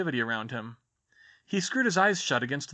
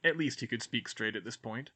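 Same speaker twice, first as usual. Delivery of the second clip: ordinary delivery, nothing marked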